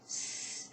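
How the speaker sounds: one long unvoiced "tss" held steady; held hiss. Shown as a demonstration. Singing teacher demonstrating a sustained support hiss. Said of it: "but hold it on".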